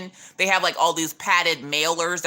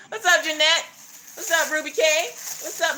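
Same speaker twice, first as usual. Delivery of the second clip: usual speaking voice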